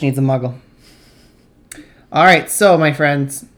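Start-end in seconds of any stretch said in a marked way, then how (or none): 2.1-3.5 loud, firm classroom address; teacher voice. Real, not acted. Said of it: "I just used my teacher voice".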